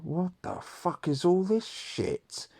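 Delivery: high, slow angry dad impression; imitating a furious father; dad's outburst. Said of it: "and he's like".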